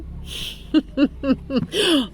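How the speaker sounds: high evil cackle; machiavellian laugh. Pretend. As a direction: cackle like a scheming villain. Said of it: "j'ai un rire machiavélique".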